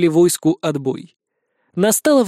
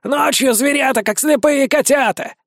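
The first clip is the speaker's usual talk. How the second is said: higher, relieved voice; relieved vampire; 7 semitones higher than usual. A narrator's reading. Vampire relieved by the easy task, high and light. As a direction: sigh it out in relief. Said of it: "облечённо вздохнул упырь".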